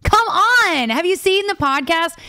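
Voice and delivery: high, loud fangirl exclamation; excited insistence. Real, not acted. Guest defends her pick of Jason Kelce, excited and insistent. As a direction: burst out high, like it's obvious. Gushing over a favorite podcast duo.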